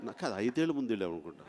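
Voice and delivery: quick hushed aside. Real, not acted.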